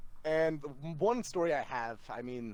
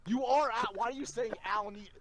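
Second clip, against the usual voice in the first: ordinary talk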